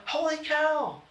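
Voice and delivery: high, sudden outcry of surprise; emphatic exclamation. Shown as a demonstration. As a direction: demonstrate an exclamation point with your voice. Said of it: "You see here, I went".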